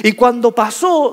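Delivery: usual speaking voice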